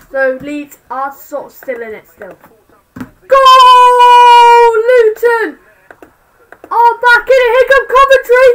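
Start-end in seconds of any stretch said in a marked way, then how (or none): 3.0-8.5 loud, high, thrilled shout; goal celebration. Real, not acted. Fan watching the scores shouts with joy at a goal. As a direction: roar it like your team just scored.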